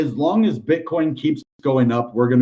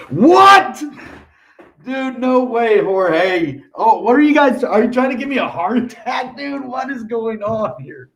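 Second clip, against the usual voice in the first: real surprise; 10 semitones higher than usual. Real, not acted. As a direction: jump up in pitch, stunned by the gift. Reacting to a surprise donation.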